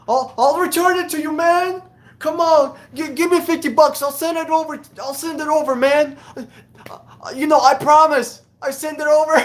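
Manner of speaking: high, pleading street-hustler voice; crackhead impression. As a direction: beg for fifty bucks like a shady hustler. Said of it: "I have hard time doing an impression".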